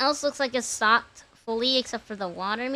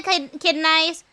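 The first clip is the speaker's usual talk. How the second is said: normal talking voice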